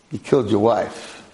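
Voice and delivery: ordinary talk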